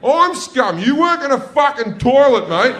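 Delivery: high, loud indignant comeback; comic shouted outrage; outraged retort. Acted. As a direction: yell back at the attendant, affronted. Retort shouted at toilet attendant.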